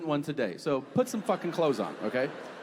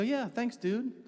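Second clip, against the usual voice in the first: casual thanks; 9 semitones higher than usual. Retold or imitated. Comic accepts bread from stranger, high airy thanks. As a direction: toss off a light, airy thanks. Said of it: "I go".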